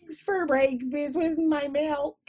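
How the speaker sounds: mock whiny child asking for eggs; whiny kid voice. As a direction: whine like a small child for breakfast. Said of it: "the way you're doing it".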